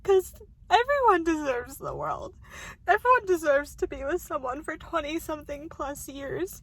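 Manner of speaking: crying hard and loud over being hurt; loud tearful outburst. Real, not acted. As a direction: cry out through the tears. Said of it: "I'm all emotional about it".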